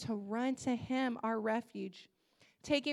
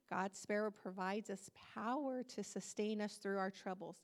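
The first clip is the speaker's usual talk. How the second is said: softer recap; 4 semitones lower, 8 dB quieter than usual. Shown as a demonstration. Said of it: "Listen to this".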